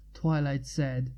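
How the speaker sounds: plain reading voice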